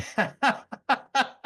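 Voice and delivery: a high burst of open laughter; real laugh. Real, not acted. Laughing at the host's mock-sceptical questions, a real high laugh. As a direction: laugh out loud at the joke.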